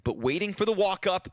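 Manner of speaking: animated, driving match commentary; esports play-by-play. Acted. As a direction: call the round as it unfolds.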